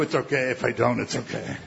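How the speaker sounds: ordinary talk, nothing marked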